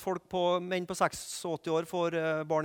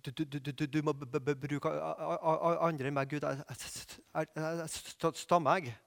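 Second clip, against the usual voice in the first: much softer, halting, stuck on every first sound; a timid Moses stuttering out his excuse to God; stammering Moses; 4 semitones lower, 7 dB quieter than usual. Retold or imitated. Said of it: "og man tror at han stammer".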